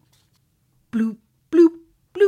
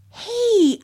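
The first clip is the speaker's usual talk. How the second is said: high, loud "hey"; eager child calling out; excited hail; 12 semitones higher, 9 dB louder than usual. Acted. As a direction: burst in with an idea. Olivia has an idea.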